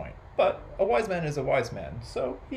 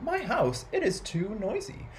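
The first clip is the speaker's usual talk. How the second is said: high-pitched voiced complaint; plaintive complaint; 9 semitones higher than usual. A narrator's reading. Peter telling the wise man his trouble, plaintive. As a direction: lift the pitch and moan about it.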